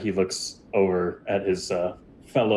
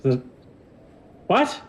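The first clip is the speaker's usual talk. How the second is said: high, baffled question; suspicious lizard wizard; 11 semitones higher than usual. Acted. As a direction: shoot it back high and puzzled. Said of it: "narrows his eyes at you and goes".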